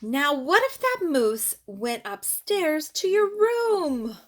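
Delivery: lively, singsong picture-book read-aloud; reading to children. Acted. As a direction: read it to a little one, bouncing the rhymes.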